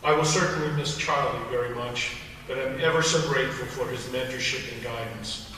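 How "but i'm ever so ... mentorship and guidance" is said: ordinary delivery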